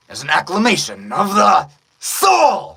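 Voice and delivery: slow, big shouted anime declaration; anime line shouted. Acted. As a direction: belt it out to the rafters. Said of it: "figure out how to make that natural".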